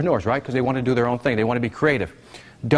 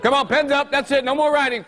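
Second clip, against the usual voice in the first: countdown call; 8 semitones higher, much slower than usual. Real, not acted. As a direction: call time like a game show host. Teacher calling time on class.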